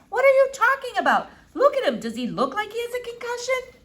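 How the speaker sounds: exasperated Flora. A narrator's reading. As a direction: throw up your hands at the doctor.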